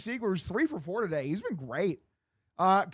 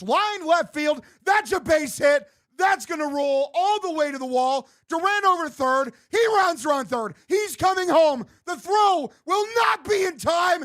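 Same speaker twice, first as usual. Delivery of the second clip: excited call; 5 semitones higher, 4 dB louder than usual. Real, not acted. Fan calling a go-ahead run.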